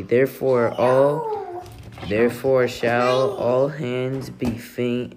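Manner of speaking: usual speaking voice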